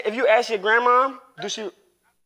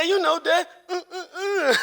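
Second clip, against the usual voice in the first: high, laughing stammer; giggling kid impression; laughing son voice; 9 semitones higher than usual. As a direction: stammer it out through laughter, imitating the boy. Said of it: "He said".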